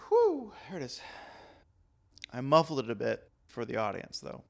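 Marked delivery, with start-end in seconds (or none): 0.1-1.0 breathy relief right after sneezing; after a sneeze. Real, not acted. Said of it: "I have to sneeze".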